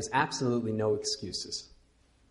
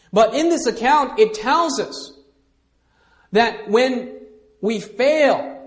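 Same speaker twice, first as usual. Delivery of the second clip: rising, louder preaching push; 8 semitones higher, 6 dB louder than usual. Acted. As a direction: preach it with mounting fervour. Preaching at full force.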